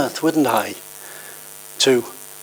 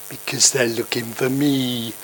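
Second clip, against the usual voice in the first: put-on soft, mischievous little-girl voice; a little girl owning up softly to the caller; giggly little girl; 3 semitones lower, 8 dB quieter than usual. A narrator's reading. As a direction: confess it like a cheeky child. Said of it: "the little girl had giggled".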